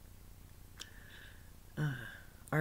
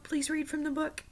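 put-on much higher childlike plea; pleading child; 11 semitones higher than usual. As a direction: ask like a small child begging.

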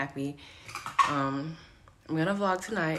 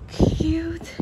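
excited squeal; 11 semitones higher, 7 dB louder than usual. Real, not acted. Squealing at the house.

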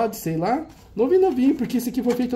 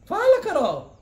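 pushing her to say it; 11 semitones higher, slower than usual. Real, not acted. Urging Carol to say it.

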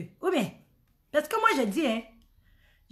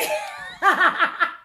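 high laughing yelp; loud laughing shout; 7 semitones higher, 5 dB louder than usual. Real, not acted. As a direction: whoop with laughter. Rit fort au nom de Tata. (cri de rire aigu)

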